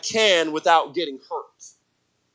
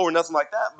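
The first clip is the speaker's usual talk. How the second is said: ordinary delivery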